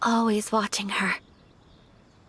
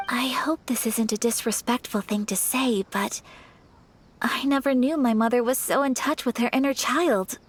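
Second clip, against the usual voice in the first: ordinary delivery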